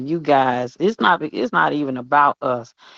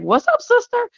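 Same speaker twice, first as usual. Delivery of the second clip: high, playful voice of a friendly coworker greeting her; voicing a grinning regular who greets her every day; imitating the cheerful man. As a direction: pitch way up for his happy hello. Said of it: "and be like".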